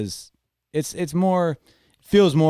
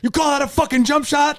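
high mock-yelled taunt; harsh parent taunt; 14 semitones higher than usual. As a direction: taunt it like a scornful dad at a game. Mocking a harsh parent coach.

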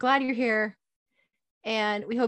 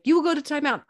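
higher-pitched commanding mom; firm parental order; 7 semitones higher, 4 dB louder, faster than usual. Acted. Mother sends defiant daughter to timeout, firmly. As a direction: give an order that allows no argument.

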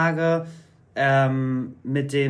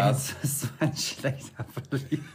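laughing. Real, not acted. Teasing her friend about losing, laughing.

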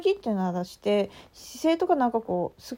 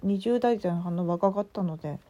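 usual speaking voice

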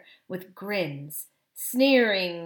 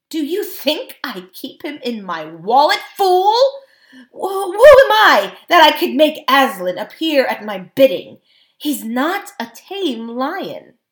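loud, high indignation; heated king losing his temper; angry retort; 6 semitones higher, 12 dB louder than usual. A narrator's reading. King snaps back angrily.

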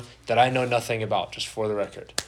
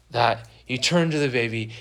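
ordinary delivery

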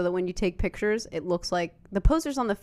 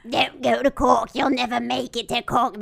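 raspy, higher New Jersey smoker voice, louder; heavy smoker New Jersey accent; 5 semitones higher, 5 dB louder than usual. Retold or imitated. Tourist lady warning them off Cork, in a heavy smoker New Jersey accent. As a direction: rasp it out like a chain-smoking Jersey lady. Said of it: "This is how she sounds".

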